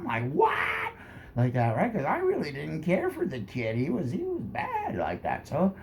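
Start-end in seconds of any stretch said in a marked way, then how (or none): 0.3-0.9 squeaky disbelieving what, pitched way up; a startled yelp of disbelief; shocked what. Retold or imitated. Narrator's shocked reaction quoted.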